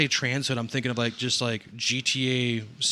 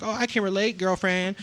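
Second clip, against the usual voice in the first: much higher, sing-out mock girlfriend; gushing girlfriend voice; 8 semitones higher than usual. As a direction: put on a high, chummy girlfriend voice.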